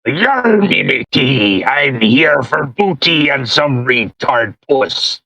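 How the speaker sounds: pirate voice. Retold or imitated. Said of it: "you weren't very raspy".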